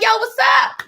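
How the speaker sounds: high, easy hello to a band; retold casual yo to the band; casual greeting. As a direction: toss out a breezy high greeting.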